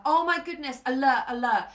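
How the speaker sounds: acted urgent panic; mock alarm. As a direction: sound the alarm as an alert nervous system. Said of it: "your nervous system is like".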